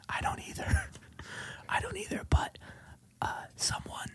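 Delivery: whispered agreement with a small laugh; ASMR whisper. Pretend. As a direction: keep the hushed ASMR voice. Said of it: "the A S M R Minute".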